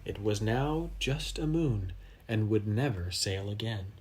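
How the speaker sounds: ordinary delivery, nothing marked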